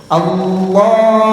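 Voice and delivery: slow, drawn-out Allahu with audible breath on the h; breathy h in Allahu. Shown as a demonstration. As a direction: let the air out on the h of Allahu. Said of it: "Anginnya dihembuskan dulu".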